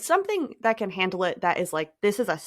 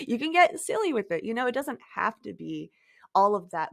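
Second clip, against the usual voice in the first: usual speaking voice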